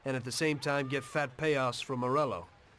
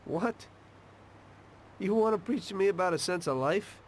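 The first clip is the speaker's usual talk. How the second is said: high-pitched, indignant questioning; raised-pitch disbelief; incredulous; 7 semitones higher than usual. Acted. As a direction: throw the advice back as a disbelieving question. Challenged about meaning of his life.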